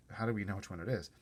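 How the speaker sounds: usual speaking voice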